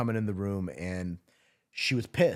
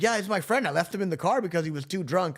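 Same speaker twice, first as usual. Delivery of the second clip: higher, louder mock Joel, casual; tossed-off friend voice, pitched up; imitating Joel; 9 semitones higher, 3 dB louder than usual. Friend telling paramedics, voiced higher and louder.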